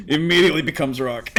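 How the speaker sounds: laughing hard mid-sentence. Real, not acted. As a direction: speak through a big laugh. Bursting laugh at joke.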